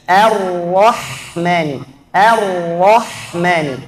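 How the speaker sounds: slow Ar-Rahmani, thin a, thick ra, thin ha; teacher drills the word; correct Ar-Rahmani. Shown as a demonstration. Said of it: "A tipis, ra tebal".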